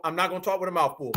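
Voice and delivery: normal talking voice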